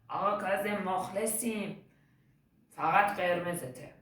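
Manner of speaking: loud, stretched-out street call; acted fan's hail. Boy passing the car hails the footballer, acted loud call. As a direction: hail your idol as you pass.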